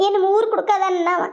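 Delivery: normal talking voice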